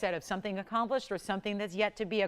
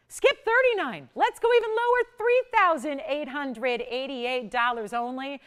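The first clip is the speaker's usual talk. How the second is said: much higher and louder, then slowing on the final price; excited price drop; 5 semitones higher, 5 dB louder than usual. Real, not acted. Shopping host to callers, announcing a slashed price, thrilled and loud. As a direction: sell the big markdown like it thrills you.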